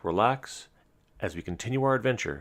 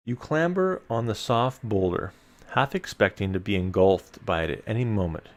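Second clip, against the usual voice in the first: measured gamebook reading to the listeners; story narration; 5 dB louder than usual. A narrator's reading. Host reading gamebook text to listeners, storyteller narration. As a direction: read the dungeon text like a storyteller. Reads gamebook passage aloud.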